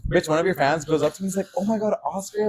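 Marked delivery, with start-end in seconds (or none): 1.6-2.5 high-pitched fan greeting; mock fangirl excitement; imitated gushing fan. Voicing a fan meeting her.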